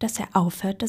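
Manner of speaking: ordinary delivery, nothing marked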